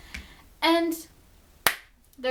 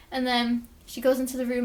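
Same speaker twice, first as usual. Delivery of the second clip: ordinary talk, nothing marked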